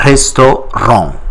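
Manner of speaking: restaurant in French with a silent final T; French pronunciation. Shown as a demonstration. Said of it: "it is not pronounced".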